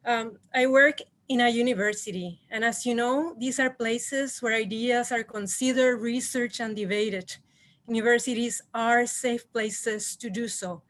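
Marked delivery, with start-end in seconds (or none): none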